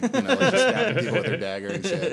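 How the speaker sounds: cracking up mid-sentence; laughing. Real, not acted. Telling friends about the comedy show, laughing. Retelling a comedian's stabbing bit.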